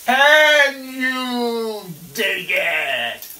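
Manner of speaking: hyping up viewers, loud and drawn out; exuberant call. Real, not acted. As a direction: stretch every word like a showman.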